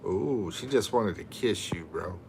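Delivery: usual speaking voice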